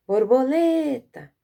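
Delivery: high, loud call stretching LE in Borboleta. Shown as a demonstration. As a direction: call Borboleta from afar, holding the stressed syllable. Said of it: "se nós chamarmos ela".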